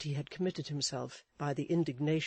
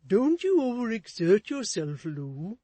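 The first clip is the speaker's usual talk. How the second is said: raised, fussy husband voice; Mister Chick's voice; 6 semitones higher, 9 dB louder than usual. A narrator's reading. Husband fussing at his wife, in a raised fussy voice. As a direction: fuss over his wife anxiously.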